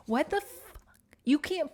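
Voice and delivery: high-pitched, cut-off curse; outraged disbelief. Real, not acted. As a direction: squeak out an appalled reaction. Outraged at police's bluntness.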